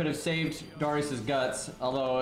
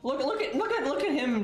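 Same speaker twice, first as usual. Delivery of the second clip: excited; 10 semitones higher than usual. Real, not acted. Excited at enemy move.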